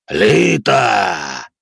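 thunderous roar of a demon lord; booming thunder and wind. A narrator's reading. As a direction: boom the name like thunder. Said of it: "громыхнул голос".

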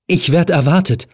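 trying to sound calm but scared; fear in every syllable. A narrator's reading. Helen to the man blocking the door, fear seeping through. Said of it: "Angst zwischen jede Silbe sickerte".

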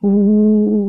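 long drawn-out mournful howl. A narrator's reading. Rabbits tormented by food memories, howling. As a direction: howl on a sustained "oo". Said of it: "у завыли кролики".

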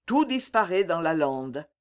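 plain reading voice

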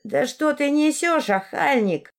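high, drawn-out indignant scolding; indignant old woman. Acted. Old woman scolds insolent lad.

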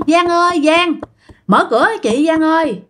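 high acted call, moderate volume; calling at door. Ghost knocking and calling the young aunt, high pleading call. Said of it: "Mà ở ngoài là cứ gõ".

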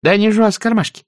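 put-on high, quick servant girl; a hint of a chattering maid; lightly voiced maid. A narrator's reading. Maid Prissy answers her mistress quickly. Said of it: "сказала Присси".

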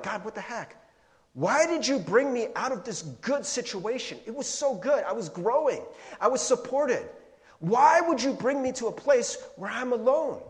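high-pitched, loud plea of protest; acted-out exasperated outcry; frustrated complaint. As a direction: demand answers from God, voice pushed up high. Voicing frustrated complaint to God.

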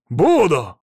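loud, high one-word blurt; emphatic retort. A narrator's reading. Husband answering officer's question, emphatic retort. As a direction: fire back at full voice.

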